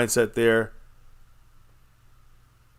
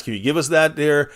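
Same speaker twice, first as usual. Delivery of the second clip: usual speaking voice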